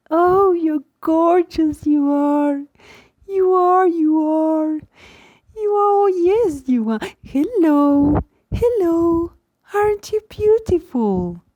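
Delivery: put-on high, loud, slow cooing baby talk; imitating a mother's baby talk. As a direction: coo at a newborn in your arms. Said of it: "Here's an actual example".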